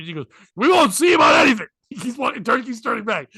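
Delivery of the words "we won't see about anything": high, loud shouted refusal; explosive mock shout; retold angry refusal. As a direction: act out a furious owner cutting someone off. Retold husband yelling at waitress.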